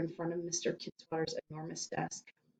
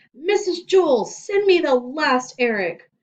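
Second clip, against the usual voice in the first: loud, higher intercom call; 9 semitones higher, 10 dB louder than usual. Acted. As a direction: bellow into the loudspeaker. Principal calls over loudspeaker.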